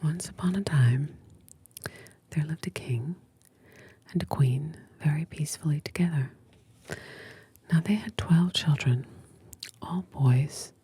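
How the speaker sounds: soft unvoiced whisper, close to the mic; ASMR whisper. Real, not acted. Host whispering to a sleepy listener, ASMR whisper. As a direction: breathe every word like a bedtime secret.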